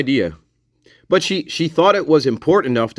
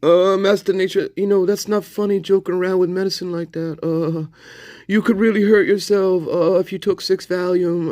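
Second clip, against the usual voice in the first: high put-on voice of a scolding frontier dad; hammed-up folksy character; 8 semitones higher than usual. Mock TV dad scolding him about the Valium, high put-on voice. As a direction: lecture him like a corny TV father, pitched up.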